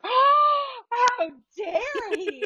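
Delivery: high gasping oh, then slow how dare he; gasp, then drag it out in shock; mock indignation. Retelling shocked outrage at being blocked, slow and high.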